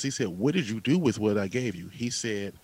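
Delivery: usual speaking voice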